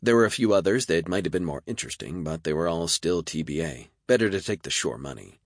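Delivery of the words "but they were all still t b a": ordinary delivery, nothing marked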